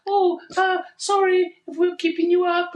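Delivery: very high mock-posh apology; hoity-toity mockery. Passengers mocking the tired man's accent, hoity-toity. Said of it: "in a really hoity-toity way".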